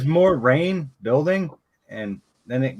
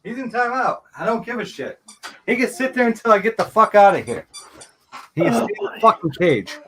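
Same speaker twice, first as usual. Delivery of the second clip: exasperated; 8 semitones higher than usual. Real, not acted. Refusing to let him out.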